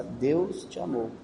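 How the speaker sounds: AMOU drawn out softly after a slow DEUS; slow dictation. Shown as a demonstration. Giving a child the line to repeat, slow dictation.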